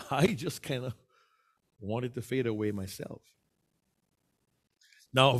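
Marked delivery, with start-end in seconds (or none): 0.1-3.2 hesitant, then quietly fading out; fading away. Shown as a demonstration. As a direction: voice sinking softer and lower. Demonstrates shrinking into invisibility.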